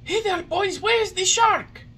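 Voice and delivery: high, loud puppet voice; Pinocchio calling out. A narrator's reading. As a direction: call out in a high-pitched puppet squeal. Pinocchio calls to his playmates.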